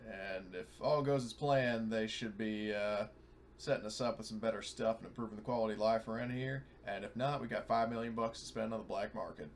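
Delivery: ordinary delivery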